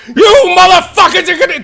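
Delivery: imitating dad yelling about the lilies; dad's angry yell. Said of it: "I saw my dad".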